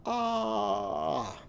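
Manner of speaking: "Ah" as a drawn-out phonics vowel; isolated vowel sound. Shown as a demonstration. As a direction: sing out the middle sound "Ah" for the class. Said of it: "What sound do you hear?".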